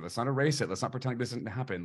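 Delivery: ordinary talk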